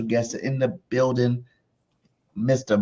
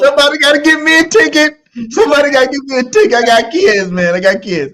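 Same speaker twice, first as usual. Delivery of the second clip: loud, high pleading yell; loud, high playful begging; excited plea; 13 semitones higher, 8 dB louder than usual. Real, not acted. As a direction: beg for a ticket in playful uproar. Begging for Brazil ticket.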